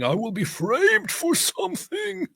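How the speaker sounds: very high, drawn-out mock wail; mock framed victim. Mocking a streamer's plot claim, high drawn-out wail. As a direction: wail it slowly, hammed-up and squeaky. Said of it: "and saying".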